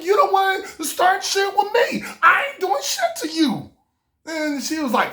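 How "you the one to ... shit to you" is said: high-pitched, indignant comeback; acted-out quarrel, pitched up; retold angry protest. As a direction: protest hotly in a much higher voice.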